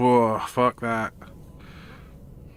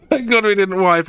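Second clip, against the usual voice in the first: laughing with relief, higher and louder; real relieved laughter; 8 semitones higher, 5 dB louder than usual. Real, not acted. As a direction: laugh it out, glad to survive. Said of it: "All I was thinking".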